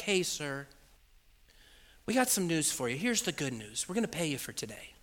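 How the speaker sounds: low, soft official voice; careful news-breaking. Retold or imitated. Staff breaking news to patient, soft and careful. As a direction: break it gently, keeping it low.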